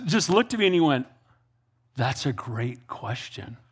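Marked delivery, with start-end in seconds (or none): 2.0-3.6 slow, low, soft admission; hushed, thoughtful concession; stunned, subdued reply. Retold or imitated. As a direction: let it out slowly and quietly, low in the voice. Stunned uncle answers after the silence.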